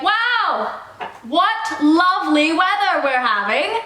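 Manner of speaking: theatrical musing aloud to the air; announcing to the air. Shown as a demonstration. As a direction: exclaim it grandly to nobody in particular. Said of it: "just loudly speak to the air".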